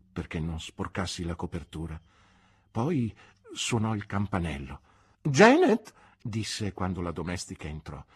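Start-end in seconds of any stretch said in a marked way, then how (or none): 5.2-5.9 very high, loud call. Acted. Aunt calling her maid into the room, loud call. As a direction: summon the maid with a ringing call.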